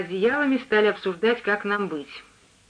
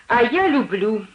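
"А я люблю" slow and emphatic; pointedly expressive; 3 semitones higher, slower than usual. A narrator's reading. Cook counters the lodger who dislikes sausages, pointedly expressive. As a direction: draw out "я люблю" with pointed relish. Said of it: "сказала она выразительно".

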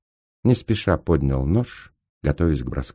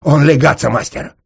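loud, raised, straining voice; hoarse croak; 10 semitones higher, 10 dB louder than usual. A narrator's reading. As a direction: rasp it out mid-struggle. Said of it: "прохрипел будочник". (хрипло, громко, с натугой)

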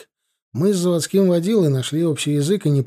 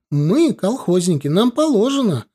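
high indignant protest, climbing higher; acted raised-voice entitlement; loud wailing outcry; 7 semitones higher, 3 dB louder than usual. A narrator's reading. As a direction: clamour for your rights, rising in pitch. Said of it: "начала голосить молодая".

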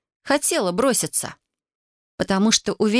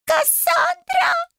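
extremely high, drawn-out shriek; squealing panicked creature voice, slow; terrified wail; much slower than usual. A narrator's reading. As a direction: howl for help like a frightened owl. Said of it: "вновь завопила белка".

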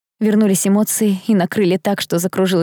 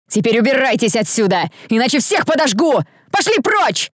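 angry shout rising very high at the end; threaten, building to a cry; furious command; 5 semitones higher than usual. A narrator's reading. Accused girl driving off the crowd, furious threat. Heroine drives the crowd away.

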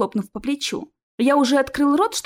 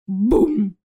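booming imitated door crash; booming thud. Troll smashing doors in the corridor, booming. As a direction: voice a heavy, resonant bang.

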